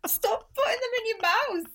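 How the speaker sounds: very high, squeaky begging; high-pitched mock despair; mock horrified plea. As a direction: plead in hammed-up distress. Acted horrified plea to Laura.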